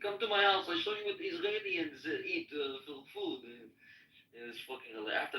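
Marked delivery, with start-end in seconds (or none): 0.0-3.4 put-on light Israeli accent, higher; lightly imitating an Israeli accent. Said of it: "he had the Israeli accent".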